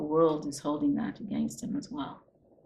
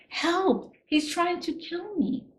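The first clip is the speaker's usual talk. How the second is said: imitated cry for help; 13 semitones higher, slower than usual. Woman yelling out her door for help, retold in a high mock cry. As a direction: act out her yelling for help in a much higher voice. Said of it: "and she yells out her door".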